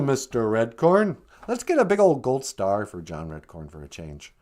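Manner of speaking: usual speaking voice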